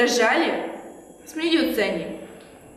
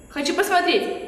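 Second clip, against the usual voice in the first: ordinary delivery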